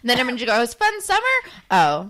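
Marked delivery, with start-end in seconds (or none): 0.7-1.2 high, sing-song question; high teasing voice. Retold or imitated. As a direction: ask it sweetly, way up high. Imogen's line voiced.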